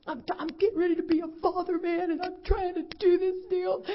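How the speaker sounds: high, fast, tearful pleading; imitating son crying. Son outside smoking, pleading with mom through tears. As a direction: mimic the son choking up over becoming a father. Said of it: "he goes".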